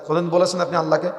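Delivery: usual speaking voice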